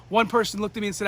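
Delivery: ordinary delivery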